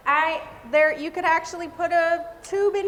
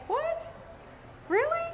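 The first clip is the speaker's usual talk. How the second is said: mock surprise; 13 semitones higher, 6 dB quieter than usual. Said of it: "And I was like".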